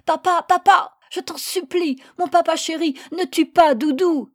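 put-on high, loud child's scream, choking on the words; a small boy shrieking to stop his father. A narrator's reading. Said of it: "hurla en s'en étranglant".

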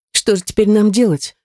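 ordinary delivery

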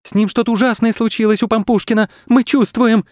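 put-on high, alarmed children's voice; acted high-pitched alarm; startled. A narrator's reading. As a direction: worry aloud as frightened kids. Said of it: "вздрогнули Саша и Паша".

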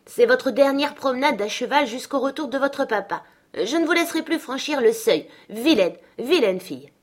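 gronder plus haut et plus fort; la gouvernante sermonne la jeune fille; réprimande sévère. Acted. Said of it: "sous un air irrité". (réprimande indignée, voix haute)

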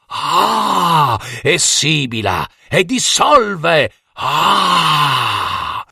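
rising then falling incantation, drawn out; spell incantation. A narrator's reading. Aunt Elisetta casts a beneficial spell over the cauldron, incanting. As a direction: chant a spell with long exhaled "ah" between lines. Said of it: "pronunciò un incantesimo benefico".

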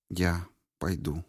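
тихо, почти беззвучно; сказать едва слышно, окончательно; тихонько. A narrator's reading. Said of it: "тихонько сказала она". (тихо и обречённо)

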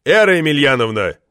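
exasperated call to order; stern interruption. A narrator's reading. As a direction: cut the speaker off sharply. Chairman cuts off ranting woman, bangs table.

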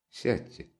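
soft, gentle invitation to sit; quiet and tender. A narrator's reading. Raskolnikov gently asks Sonya to sit, tender and soft. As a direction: ease her down kindly and quietly. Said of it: "переменившимся, тихим и ласковым голосом".

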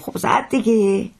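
high, drawn-out dismissive answer; impatient retort. Acted. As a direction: stretch it out as if it's obvious. Onlooker answers the baffled coachman.

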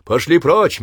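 high, loud commanding yell; shouting over crowd. Acted. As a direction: bark orders over the noise. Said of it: "выкрикивал заглушаемой толпой".

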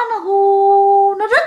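high, long drawn-out "hoo"; slow, high eerie whoosh; imitated wind howl. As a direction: mimic wind wailing down a phone line. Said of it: "giống như là có tiếng gió".

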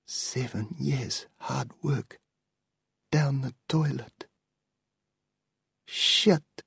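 soft, hushed despair, slowed; a stunned man murmuring over his lost books; whispered. Acted. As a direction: breathe it out under the voice. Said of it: "he whispered".

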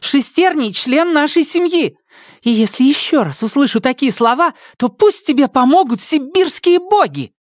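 high, heated defence; fierce warning. Acted. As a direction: warn him off hard. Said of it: "рявкнула Ника".

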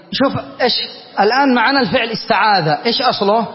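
normal talking voice

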